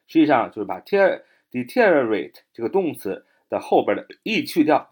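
ordinary talk, nothing marked

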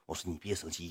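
hushed, low retold pleading; coaxing. Streamer placating an annoyed donor, softly retold coaxing. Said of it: "一顿哄".

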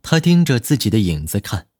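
ordinary delivery

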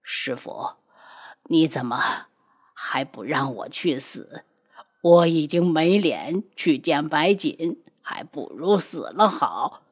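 low, soft and dragging, half breathless; a broken man who wants to die; bitter weary. A narrator's reading. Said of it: "他苦笑道".

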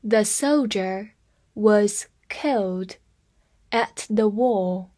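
slow, word by word; teacher slowing down for students; slow read. Shown as a demonstration. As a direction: read "The soldier was killed at the war" slowly in chunks. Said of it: "我们慢慢来读一下".

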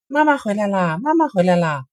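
little rabbits shout that mother is home; excited cry. A narrator's reading. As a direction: bunnies calling out with joy. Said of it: "一起叫起来".

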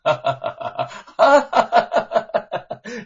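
high loud cackling laugh; loud laughter. Acted. Young man laughing as guards haul him in, loud laughter. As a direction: laugh out loud while being dragged. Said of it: "禁不住的大笑着".

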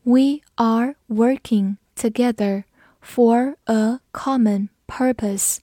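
slow, word by word with pauses; slow, careful model reading; slow read-through. Shown as a demonstration. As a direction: read it slowly for learners to follow. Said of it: "跟着我慢读一遍".

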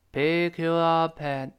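'pick up at' stretched out and joined in slow motion; slow linking. Shown as a demonstration. Teacher breaking down the link, slow-motion 'pick up at'. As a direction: draw each linked syllable of 'pick up at' out evenly. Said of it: "轻轻的那个up".

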